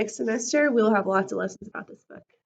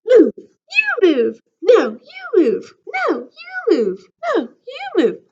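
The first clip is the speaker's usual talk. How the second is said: high, loud bickering cries; squabbling; 10 semitones higher, 10 dB louder, slower than usual. Acted. Cookies squabbling in the cookie jar, high loud bickering. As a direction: push back at each other, whiny and cross.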